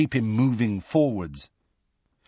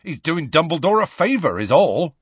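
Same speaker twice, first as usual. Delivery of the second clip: higher, scornful correction; scathing; 7 semitones higher, 4 dB louder than usual. A narrator's reading. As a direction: set them straight scathingly. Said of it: "said Hagrid scathingly".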